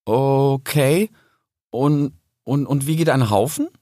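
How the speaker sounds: drawn-out, unsure okay; hesitant question. Acted. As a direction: feel your way into the question. Puzzled fox asks about rules. (zögernd fragend)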